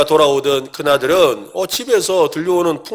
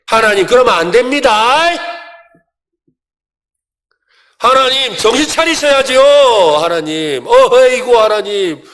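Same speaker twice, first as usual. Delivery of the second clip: mock Jonah scolding God; 6 semitones higher, 6 dB louder than usual. Jonah nagging God not to spare the city, mock-exasperated. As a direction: play the sulky prophet lecturing God.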